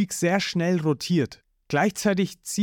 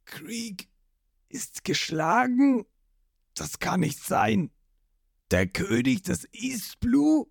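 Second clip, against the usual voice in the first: stunned disbelief; 6 semitones higher, slower than usual. Acted. Jin disbelieving.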